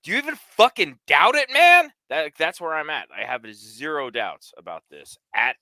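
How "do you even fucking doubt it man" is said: much higher and louder, rising to a yell on "man"; building shout, pitch and volume climbing to the end; Mister Pink outburst. Retold or imitated. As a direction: explode like the Reservoir Dogs scene. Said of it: "where Mister Pink is like".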